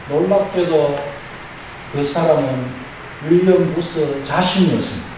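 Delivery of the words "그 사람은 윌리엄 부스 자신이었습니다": ordinary talk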